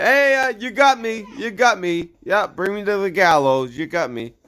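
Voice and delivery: high, put-on mock surrender; mock confession. Host imagining the accused owning up, high mock confession. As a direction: give yourself up in a squeaky, hammed-up voice.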